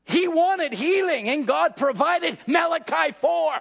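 fiery revival preacher shouting the promise; preaching. Acted. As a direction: drive it home from the pulpit. Preaching at full force.

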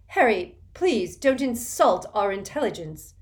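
loud, sharp rebuke; exasperated scolding. Acted. Hermione scolding Harry, sharp and exasperated. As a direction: cut him off in exasperation.

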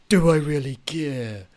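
badly faked Alistair accent, drawn out. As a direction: put on a terrible, slow Alistair accent. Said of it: "it was terrible Alistair accent".

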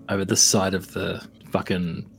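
normal talking voice

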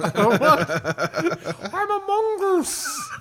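very high, slow squeaky creature; squeaky mongoose voice. Retold or imitated. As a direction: squeak it out, laughing. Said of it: "The Jeff the Mongoose voice".

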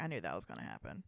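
deep, quick muttered aside; low fast mumble. Retold or imitated. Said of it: "I was like".

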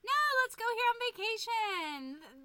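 high, loud chirpy voice; cheery self-imitation. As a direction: chirp it like a cheery tour guide. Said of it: "I'm just like".

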